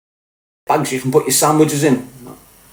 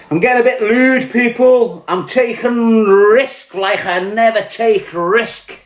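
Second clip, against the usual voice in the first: excited; 7 semitones higher, slower than usual. Acted. Raised excited voice.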